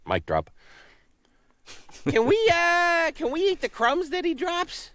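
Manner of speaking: high, excited goose voice; a dim, eager goose. Acted. Goose character.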